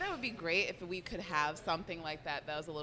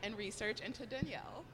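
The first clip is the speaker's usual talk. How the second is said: normal talking voice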